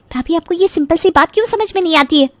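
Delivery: high-pitched irritated complaint; peeved young sister-in-law; annoyed sister-in-law. Acted.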